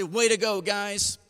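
imitating an encouraging coach. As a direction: cheer the team on like an upbeat coach. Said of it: "he was an incredible encourager".

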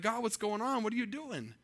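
mock pleading with God, mildly frustrated. Preacher retelling his complaint to God at the yard sale, frustrated. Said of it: "I'm frustrated".

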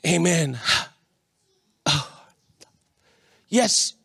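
ordinary delivery

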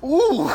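high, loud reactive "ooh"; retold squeamish ooh. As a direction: let out a sudden high mock yelp. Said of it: "you just hear me go".

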